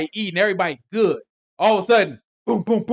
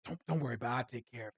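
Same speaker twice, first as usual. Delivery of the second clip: much softer, lower aside; calm his guests quietly before slipping out; hushed reassurance; 4 semitones lower, 11 dB quieter, much faster than usual. Retold or imitated. Lot quietly assuring his guests at the table. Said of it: "He look like".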